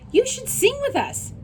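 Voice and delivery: way up high, sing-song invitation; high bird chorus; high chirpy birds. Acted. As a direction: tweet it like a flock of birds. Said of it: "said the birds".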